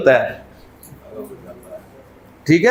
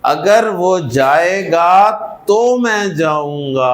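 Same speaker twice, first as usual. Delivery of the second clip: slow demo; 3 semitones lower, much slower than usual. Shown as a demonstration.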